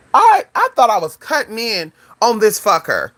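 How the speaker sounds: usual speaking voice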